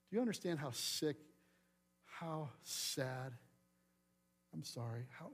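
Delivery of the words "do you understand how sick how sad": ordinary delivery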